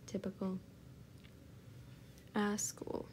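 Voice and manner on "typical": usual speaking voice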